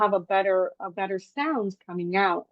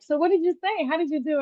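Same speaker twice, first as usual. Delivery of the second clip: higher-pitched quote of curious relatives; mock-curious family voice, pitched up; imitating eager questioning; 8 semitones higher than usual. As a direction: ask eagerly in a lifted voice, as retold.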